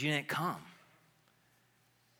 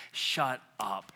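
ordinary delivery

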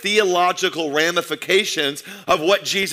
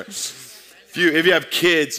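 ordinary delivery

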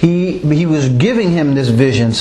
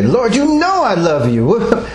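higher, slow exasperated protest; 7 semitones higher, slower than usual. Acted. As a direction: insist it, exasperated, pitched up. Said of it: "he was exasperated".

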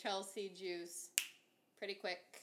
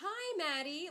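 startled greeting; 11 semitones higher, 7 dB louder, slower than usual. Retold or imitated. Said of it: "I was like".

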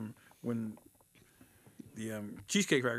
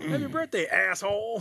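rude waiter voice; 6 semitones higher, 3 dB louder than usual. Retold or imitated. Rude staff at Dick's.

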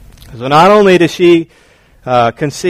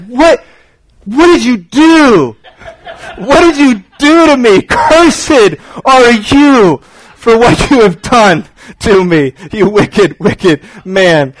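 mock angry wife; 4 semitones higher, slower than usual. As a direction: blame him like a furious pregnant wife. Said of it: "women, they get mad at their husbands".

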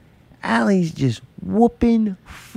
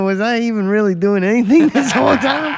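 a real laugh breaking into the question, higher; 7 semitones higher than usual. Real, not acted. Gamer wondering if he did anything at all, laughing. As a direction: laugh as you ask it. Laughing at his own uselessness.

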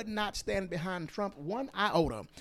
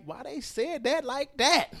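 incredulous; 8 semitones higher than usual. Retold or imitated. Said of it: "I be looking like".

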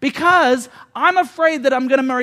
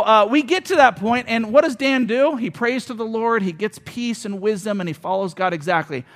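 ordinary talk, nothing marked

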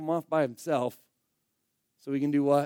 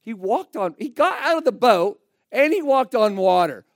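loud, higher-pitched outrage at the unfairness; indignant protest; 5 semitones higher, 7 dB louder than usual. Retold or imitated. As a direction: protest like an aggrieved kid. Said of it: "I always thought that was so unfair".

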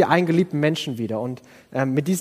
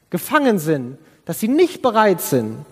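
ordinary talk

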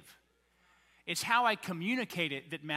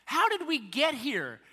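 high, loud mock bewilderment; incredulous; 7 semitones higher, 5 dB louder than usual. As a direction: exclaim it as a baffled listener, pitched up. Baffled listener reacts.

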